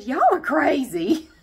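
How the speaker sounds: the lady leaning on the wall, done in; imitating the lady. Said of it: "She said".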